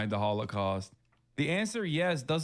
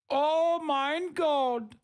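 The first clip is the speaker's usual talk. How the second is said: put-on swooning German accent; 13 semitones higher, much slower than usual. Streamer mocking starstruck Germans, peak swoon in a mock German accent. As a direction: stretch out "Oh mein Gott" in a German accent, peaking into a high, drawn-out swoon. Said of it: "Germans look at an accountant wearing a turtleneck and go".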